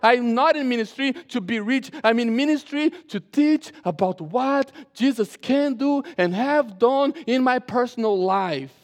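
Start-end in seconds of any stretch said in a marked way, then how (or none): 2.0-8.7 loud, raised preaching voice; forceful preaching. Acted. Pastor tells congregation why he is in ministry, forceful preaching. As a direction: preach it out with force, stressing key words.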